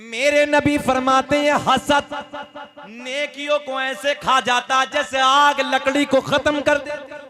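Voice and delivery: preaching at full force. Acted. Chanted hadith line.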